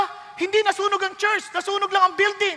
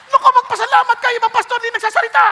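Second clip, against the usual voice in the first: teasing comeback; 5 semitones higher, 5 dB louder, much faster than usual. Real, not acted. Joking retort to complainers.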